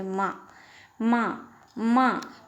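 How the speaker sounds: माँ with the vowel sounded through the nose; nasal sound demonstration. Shown as a demonstration. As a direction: repeat माँ separately, letting the nasal ring. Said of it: "नाक की ध्वनि आ रही है". (माँ का नासिका से उच्चारण)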